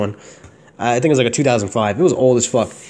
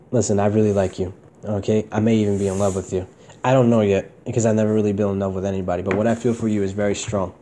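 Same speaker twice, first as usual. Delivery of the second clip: soft sincere confession; 6 semitones lower, 4 dB quieter than usual. Retold or imitated. Retelling how he confessed his feelings to her at the dock, soft and sincere. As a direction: lower and softer, tender. Asking girlfriend out, retold.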